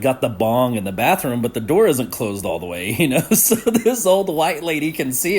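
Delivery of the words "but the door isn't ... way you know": ordinary talk, nothing marked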